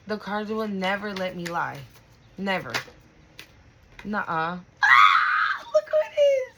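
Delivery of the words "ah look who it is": high, loud delighted shout; excited cry. Real, not acted. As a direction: cry out in joyful recognition. Excited recognition of a card.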